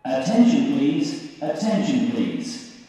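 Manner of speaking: ordinary talk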